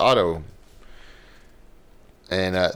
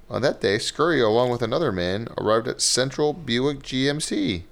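reading a news report aloud; steady newscaster read; 3 semitones higher than usual. Acted. Reads a news story aloud.

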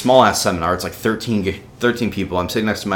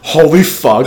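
loud, high burst of disbelief; stunned excitement; 9 semitones higher, 10 dB louder, slower than usual. Retold or imitated. Student turns to classmate with the offer, loudly stunned. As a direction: retell it as an amazed exclamation, pitched way up.

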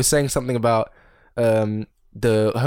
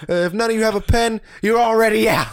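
mock dictator speech; 9 semitones higher, 3 dB louder than usual. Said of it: "And he was like".

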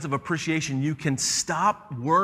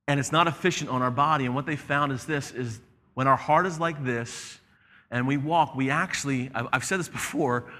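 usual speaking voice

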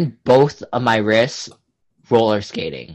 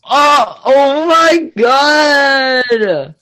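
drawn-out high shocked cry; mock shocked; 12 semitones higher, 5 dB louder, much slower than usual. Real, not acted. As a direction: gasp it out, slow and high. Reacting to pickup line.

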